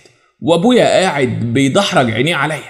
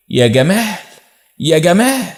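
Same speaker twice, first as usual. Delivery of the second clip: drawn name call, rising on the repeat; 4 semitones higher than usual. A narrator's reading. Stepmother calls stepson from the bathroom, calling out. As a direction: call him lightly from another room, higher the second time. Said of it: "وسمعت صفية بتنادي عليا".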